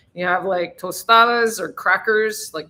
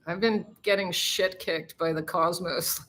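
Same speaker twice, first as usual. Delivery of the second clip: ordinary delivery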